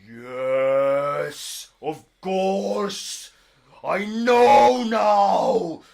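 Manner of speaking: loud, high, slow grand giant voice; excited giant. Acted. Giant realising where the necklace is, loud and high. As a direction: declaim like a giant struck by revelation. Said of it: "he's like".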